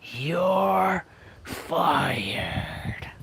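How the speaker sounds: high "You're", then "fired" breathed out in a whisper; Vince McMahon impression. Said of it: "that's how he said it".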